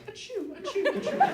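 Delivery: very high, tiny cartoon sneezes; cute boy's sneeze. Comic acting his teenage self sneezing in a row, cute high voice. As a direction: squeak out sneezes like a cute young boy. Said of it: "in my cute fourteen-year-old Chinese boy's voice".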